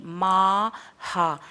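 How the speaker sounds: very slow माहा, long माँ; visarga as ha. Shown as a demonstration. Teacher repeating the right form. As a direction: stretch माहा slowly with a long a. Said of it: "महा नहीं".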